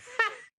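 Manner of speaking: very high fake laugh; put-on laugh. Actor laughing again despite the director's note, put-on laugh. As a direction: squeal out a short silly laugh. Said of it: "and he goes".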